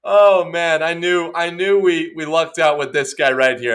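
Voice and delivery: loud, high, impressed casting executive; hammed-up delight, easing off gradually; gushing. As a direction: gush as if you've struck gold. Casting table gushes over Pratt.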